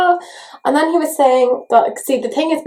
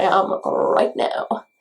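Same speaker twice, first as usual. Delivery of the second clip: whisper; 3 semitones lower, 4 dB quieter, much slower than usual. Real, not acted. Trails off whispering.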